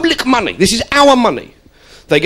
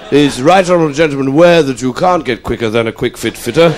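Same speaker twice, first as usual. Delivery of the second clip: lower, pompous parliamentary question; MP in Commons; 6 semitones lower than usual. Pretend. MP quoting an ad jingle in the Commons, pompous. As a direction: ask it like a solemn backbencher. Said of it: "forced to sing the jingles".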